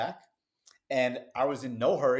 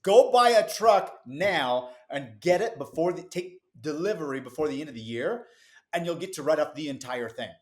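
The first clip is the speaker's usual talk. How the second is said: fired-up urging; 4 semitones higher, 7 dB louder than usual. Retold or imitated. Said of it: "And he said".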